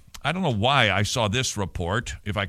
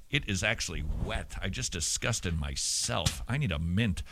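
low, soft, deflated aside; mock self-disgust; 5 semitones lower, 7 dB quieter than usual. Real, not acted. Host finding spit on the microphone, quiet self-disgust.

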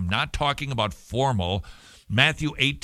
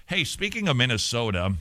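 normal talking voice

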